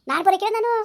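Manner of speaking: angry refusal. Acted. Refusing to go to her mother's village, shouting. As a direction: yell the refusal, stressing ನಾನು high.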